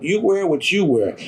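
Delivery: ordinary talk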